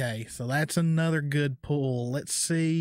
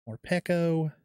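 ordinary delivery

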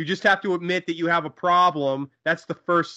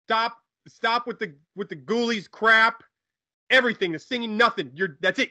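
exasperated, pitched up; fed up; 8 semitones higher than usual. Acted. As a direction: cut him off in raised, irritated tones. Fed up, throwing clown out.

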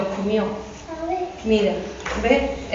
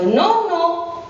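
put-on higher kid-goat voice turning him away, slower in the middle; higher, slow little goat saying the voice is wrong; brave little goat; 7 semitones higher, slower than usual. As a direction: refuse the wolf like a plucky little goat. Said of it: "le dice el cabrito".

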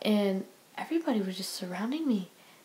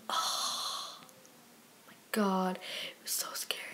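scared whisper; 6 dB quieter, slower than usual. Real, not acted. Reliving scary moment.

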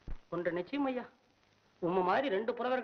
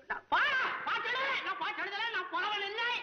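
high, stammering frantic protest; panicked denial; 8 semitones higher than usual. Acted. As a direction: deny it in a startled, high-pitched panic. பயந்து பதறி மறுத்தல். (பதறிப்போய் உச்சக்குரலில் மறுப்பு)

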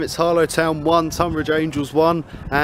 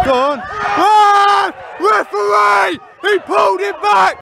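high angry shouts at the ref; yelling from the sidelines; 5 dB louder, slower than usual. Real, not acted. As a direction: protest hard to the referee. Fan shouting at referee.

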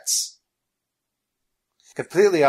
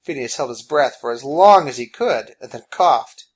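plain reading voice

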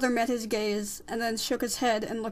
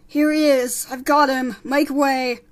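loud, raised announcing call; 3 semitones higher, 10 dB louder, slower than usual. Acted. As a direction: call out to clear the crowd. Furlough announcing through the crowd.

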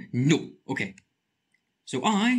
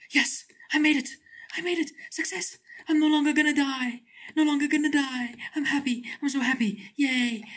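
high, elated cheering; overjoyed relief; joyful cheering; 11 semitones higher than usual. Real, not acted. Escapes alive.

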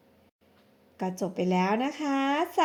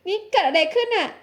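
higher, startled outburst; shocked; 6 semitones higher than usual. Acted. Woman waking beside a stranger, shocked. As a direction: wake up horrified and demand answers. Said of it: "เกือบช็อก".

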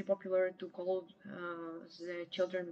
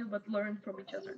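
ordinary delivery

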